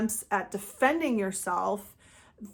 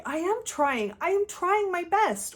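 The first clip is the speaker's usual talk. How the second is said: much higher, hurt protest; defensive reaction; 9 semitones higher than usual. Retold or imitated. Criticized by a micromanager, answering with a hurt, high protest. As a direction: protest that you're trying, voice climbing. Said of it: "natural reactionary response is to defend yourself".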